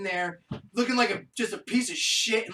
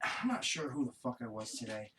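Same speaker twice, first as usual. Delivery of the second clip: ordinary delivery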